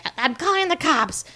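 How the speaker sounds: high-pitched mock outburst; flipping out. Headmistress threatening to call the police, flipping out. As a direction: act out a headmistress losing it. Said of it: "Miss Minchin flips".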